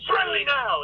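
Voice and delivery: high mock scream of a sitcom father; imitated screaming. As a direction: scream it like an agitated dad's catchphrase. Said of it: "he'd go around screaming".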